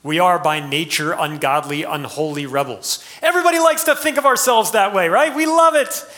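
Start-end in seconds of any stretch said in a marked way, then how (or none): 3.2-6.0 sarcastic. Acted. Preacher mocking self-flattery.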